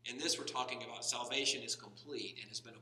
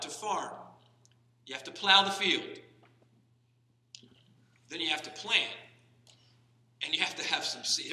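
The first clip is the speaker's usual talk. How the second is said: preaching at full force; 5 semitones higher, 10 dB louder than usual. Acted. Preaches the farmer illustration.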